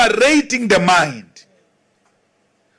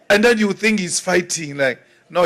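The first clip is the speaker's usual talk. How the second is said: ordinary delivery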